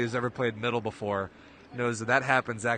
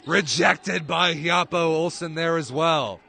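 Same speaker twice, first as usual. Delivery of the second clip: higher, louder, slow; excited block call; 6 semitones higher, 9 dB louder, slower than usual. Real, not acted. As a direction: punch out the rejection. Block called live.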